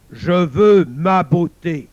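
loud, very slow, emphatic demand; demanding monster. Acted. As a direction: hammer out each word as the monster. Le monstre exige la fille. (monstre qui exige, fort et lent)

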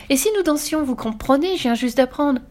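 put-on high, eager child's proposal; delighted. A narrator's reading. Girl begging prisoners to dance with her, excited. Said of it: "dit Fifi en battant des mains".

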